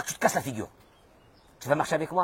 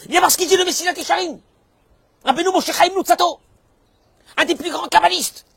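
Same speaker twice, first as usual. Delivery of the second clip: high, loud preaching shout; emphatic proclamation; 9 dB louder than usual. Real, not acted. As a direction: cry out the authority's name with force. Preacher emphatically cites his authority. (proclamation criée, aiguë et forte)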